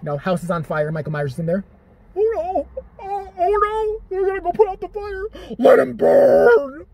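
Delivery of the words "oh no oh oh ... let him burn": much higher, drawn-out wailing cries; hysterical sobbing scream. Acted. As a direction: wail it out like a horror heroine losing it. Jamie Lee's trailer scene reenacted.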